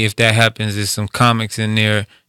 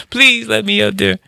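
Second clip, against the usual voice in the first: pleading comic; 11 semitones higher than usual. Retold or imitated. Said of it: "there's never that moment to say".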